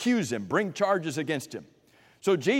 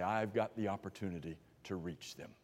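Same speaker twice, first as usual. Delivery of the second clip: quiet resolve; 8 semitones lower, 13 dB quieter than usual. Acted. Preacher voices a believer's quiet resolve.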